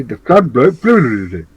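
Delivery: lightly voiced bumbling British spy; a hint of a pompous secret agent; Johnny English. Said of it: "as Johnny English would say".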